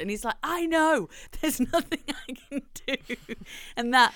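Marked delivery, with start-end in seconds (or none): 0.4-3.0 high, helpless retort breaking into laughter; mock panicked reply. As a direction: imitate a helpless driver, pitched up. Acted reply to panicking passenger.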